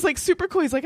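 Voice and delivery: normal talking voice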